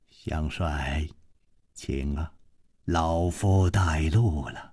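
put-on low, soft, slow elderly man; hushed, low-pitched courteous elder; old host. A narrator's reading. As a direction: usher the guest in quietly, unhurried. Old host ushers guest inside quietly.

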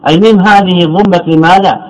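ordinary talk, nothing marked

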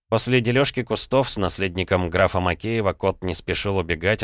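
ordinary delivery, nothing marked

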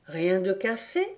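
plain reading voice

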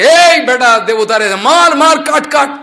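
high, loud cry to attack; battle shout. Retold or imitated. Demons yelling at the approaching gods to strike. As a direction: roar kill them at the enemy.

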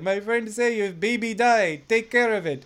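put-on French Canadian accent drifting into Russian; bad French Canadian accent. Reading trapper's intertitle aloud, botched French Canadian accent. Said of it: "French Canadian accent".

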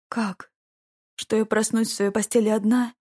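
ordinary delivery

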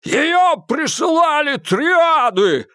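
high, slow desperate yell; bellowing. Acted. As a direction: bawl it over the river. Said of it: "проорал в ответ Малькольм". (отчаянный ор)

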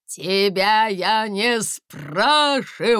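loud, slow bellowing chief; yelling. A narrator's reading. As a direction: bellow it out slowly. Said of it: "заорал начальник охраны".

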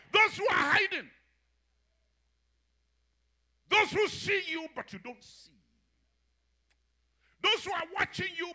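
loud, high preacher's cry; preaching at full force. Acted. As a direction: proclaim it to the congregation.

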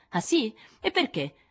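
acuto e minaccioso; sfida. Acted. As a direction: provoca la risposta. Jo sfida Amy. (Jo sfida Amy, acuta)

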